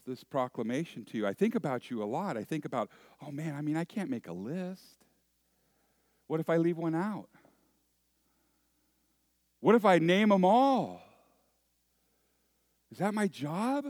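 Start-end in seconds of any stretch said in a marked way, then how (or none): 3.2-13.8 mildly anxious self-questioning, climbing in pitch; worried. Acted. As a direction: fret aloud, each question more pressing. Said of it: "I get really worried about it".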